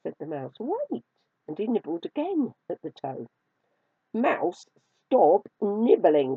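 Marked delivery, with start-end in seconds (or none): 0.6-1.1 high mouse squeak that drops at the end; tiny high-pitched mouse voice; stalling mouse. A narrator's reading. Mouse stalls the angry kitten. 4.1-6.4 loud, high kitten command; indignant storybook cry. A narrator's reading. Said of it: "cried the kitten".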